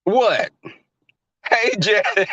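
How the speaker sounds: ordinary delivery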